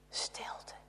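hushed dramatic whisper; softly whispered silence. A narrator's reading. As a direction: breathe the word into silence.

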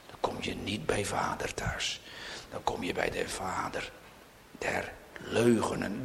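low whispered warning; quiet dread; ominous whisper. Real, not acted. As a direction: hush it like a dark secret. Hushed warning of hell.

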